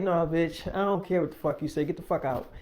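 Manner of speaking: soft, low, mock-menacing dismissal; retold cold dismissal. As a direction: imitate someone refusing an apology, voice dropped. Acted rejection of the apology.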